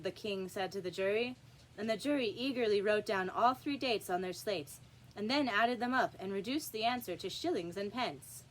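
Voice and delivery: plain reading voice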